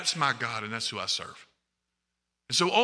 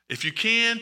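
ordinary delivery

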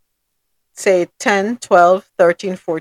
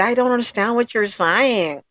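mock American accent; 6 dB quieter than usual. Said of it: "Some Americans say".

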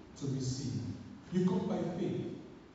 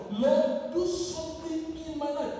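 pleading cry; 8 semitones higher, 4 dB louder than usual. Acted.

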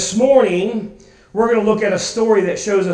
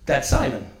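lower, deflated "That's Simon" falling away; weak Simon; 7 semitones lower, 3 dB quieter than usual. Shown as a demonstration. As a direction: let "That's Simon" drop low and weak. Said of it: "the weak Simon".

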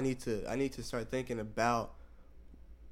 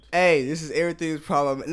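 loud, raised-pitch announcer burst; hyped show intro; 5 semitones higher, 10 dB louder than usual. Acted. Co-host grabbing the intro of the podcast, loud and hyped. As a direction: belt out the show's name.

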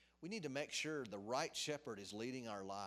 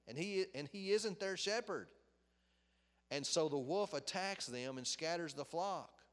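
scripture reading; 3 semitones higher, 5 dB louder than usual. Acted. Pastor reading scripture to the congregation, proclaiming it. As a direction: read it out like a proclamation.

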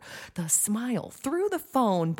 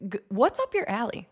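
ordinary talk, nothing marked

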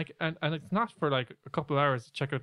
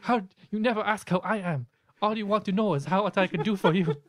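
indignant spirit guide, higher and louder; Sam; 6 semitones higher, 4 dB louder than usual. Acted. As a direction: scold in a put-on accented voice. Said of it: "You should do a Sam impression".

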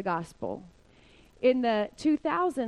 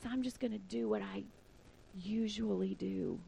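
calm confidence; 2 semitones lower, 10 dB quieter than usual. Acted. Speaker wishing for calmness in a crisis, quiet calm. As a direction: let it sink into quiet, unhurried assurance. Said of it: "confidence and calmness".

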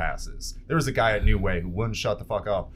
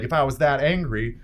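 normal talking voice

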